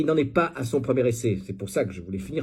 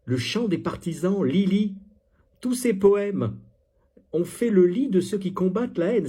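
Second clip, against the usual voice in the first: high, emphatic declamation; mock-solemn raised voice; mock poem recital; 8 semitones higher, 3 dB louder than usual. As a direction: proclaim the verse grandly. Reads out the poem mockingly.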